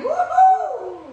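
all-out victory whoop; cheering shout. Real, not acted. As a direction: shout a triumphant woohoo. Cheering last repetition.

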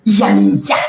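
"Yamiya" low, drawn from the guts. Shown as a demonstration. Streamer showing a gut-felt post, "Yamiya" from deep inside. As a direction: push "Yamiya" out from the belly. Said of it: "Les tripes veulent sortir".

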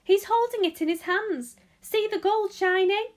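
high, loud excited onlooker; shouting. A narrator's reading. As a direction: call it out to the crowd. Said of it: "somebody else shouted".

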